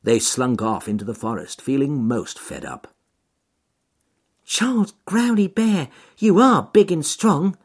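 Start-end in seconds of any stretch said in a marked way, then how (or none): 4.4-7.6 high, louder admiring rabbit voice; a grateful little rabbit praising the bear. Acted. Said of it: "said Ernest Fluffy Rabbit".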